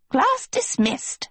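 very high, slow gnome voice; Yuba the gnome, announcing. A narrator's reading. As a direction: dismiss them in a piping, stretched-out voice.

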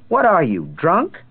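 high, loud scornful jab; angry retort. Acted. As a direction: snap it at him in disgust.

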